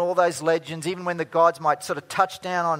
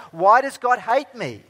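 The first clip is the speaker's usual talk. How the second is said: loud, high anguished shout at God; yelled cry of despair; 8 semitones higher, 9 dB louder than usual. Retold or imitated. Woman yelling her pain to her pastor, loud and high. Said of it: "she yelled out".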